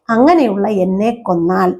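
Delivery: ordinary talk, nothing marked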